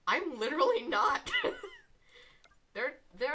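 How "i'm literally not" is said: higher, louder, breaking into laughter; laughing protest. Real, not acted. Streamer denying being sarcastic, laughing protest. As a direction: insist through a laugh.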